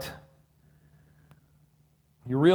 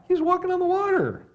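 much higher, amazed exclaim; excited exclaim; 3 dB louder than usual. Acted. Pastor to the congregation about the miracle, high amazed exclaim. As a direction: marvel at it out loud.

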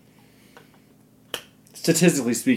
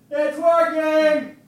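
very high, drawn-out distant holler; yelling from afar; 13 semitones higher, much slower than usual. Shown as a demonstration. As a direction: stretch each word out as you call from another room. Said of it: "go to the kitchen and yell".

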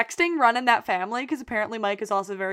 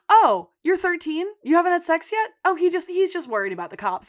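high, louder mock-casual questions; breezy retold reaction; 7 semitones higher, 4 dB louder than usual. Imitating Mandy advising Debbie, high and breezy. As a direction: pitch it up as if it's no big deal. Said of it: "She's like".

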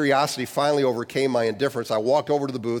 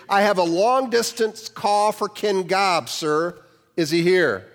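higher, a touch slow, impatient operator; 6 semitones higher, slower than usual. A narrator's reading. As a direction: repeat it with thinning patience. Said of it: "And impatiently, the operator repeated".

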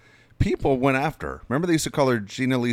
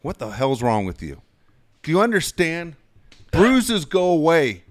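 retold soccer coach; 7 semitones higher, 5 dB louder than usual. Soccer coach to young goalie after a missed save, retold coach lecture. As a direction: drive each verdict home like a scolding coach. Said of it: "And the coach said to me".

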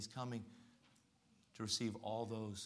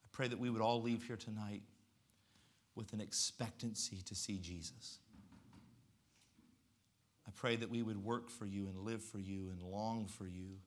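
prayer. Acted. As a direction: pray it gently and sincerely.

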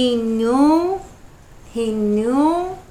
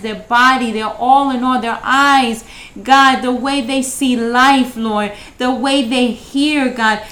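louder, steady praying voice, lifting each request; fervent prayer; 3 semitones higher, 6 dB louder than usual. Acted. Host praying aloud for listeners, fervent and louder. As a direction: pray over them with fervour, each line an offering.

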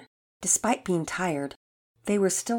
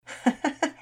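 short high-pitched laugh; acted mother's light laugh; 9 semitones higher than usual. A narrator's reading. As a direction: give a brief, high chuckle as Mom. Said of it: "Missus Hicks laughed".

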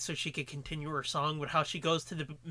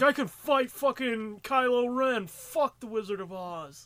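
put-on bratty kid voice; 5 semitones higher, 4 dB louder, slower than usual. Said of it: "Or it's like".

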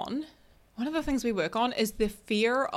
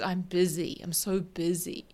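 a mild, drained sigh of "I'm so busy", with the voice sinking on "busy"; mock the overwhelmed excuse gently, trailing off; weary busy complaint; 3 semitones lower than usual. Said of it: "Another one I see commonly is".

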